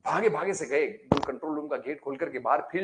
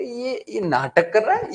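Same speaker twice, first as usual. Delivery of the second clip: slow, higher and louder disbelief; exasperated outburst; 7 semitones higher, 6 dB louder, slower than usual. Acted. Mishra ji shouts that the insulator is faking, slow and loud. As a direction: drag out ये नाटक कर रहा है in loud exasperation. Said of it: "बोले".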